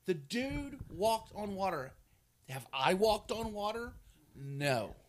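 loud, slow preacher emphasis; preaching. Real, not acted. Preacher pressing the congregation with loud questions. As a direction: drive the point home.

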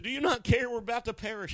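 high, loud, panicked plea; frantic plea. Retold or imitated. Disciples begging Jesus in the storm, high frantic plea. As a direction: beg him in mock terror. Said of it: "They said".

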